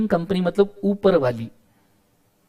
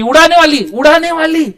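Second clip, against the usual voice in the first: the word उड़ाने called out high and loud; teacher calls out उड़ाने वाली with a big, high, loud lift; excited, high and loud; 8 semitones higher, 6 dB louder than usual. Shown as a demonstration. Teacher excitedly calls the term to students, high and loud.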